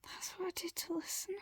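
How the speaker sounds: breathy whispered confession, quick; soft whisper to yourself. A narrator's reading. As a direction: whisper it so nobody hears. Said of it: "you whisper".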